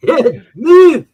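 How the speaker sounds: high, loud put-on shout; hammed-up movie yell. Host repeating the movie line as a loud put-on shout. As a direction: yell it like the film character.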